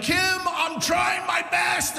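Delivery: high, drawn-out mock yell; imitated yelling. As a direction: imitate a desperate man yelling in a street squabble. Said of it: "a man and a woman yelling".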